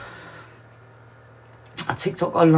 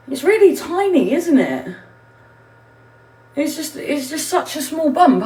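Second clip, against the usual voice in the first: proud and excited; 6 semitones higher than usual. Real, not acted. Showing off her tiny bump.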